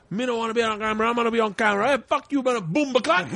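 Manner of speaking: high-pitched imitation of arguing Jamaican men; mock patois quarrel. Acting two Jamaican men arguing.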